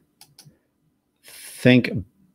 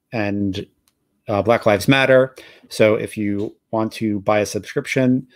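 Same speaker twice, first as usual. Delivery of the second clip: ordinary talk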